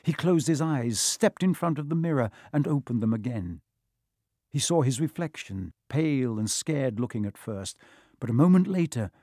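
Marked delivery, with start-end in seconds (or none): none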